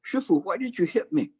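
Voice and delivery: high, aggrieved little monk; young monk protesting to the master; startled young monk. Acted. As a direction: whine it like a child just smacked. Struck monk complains to master.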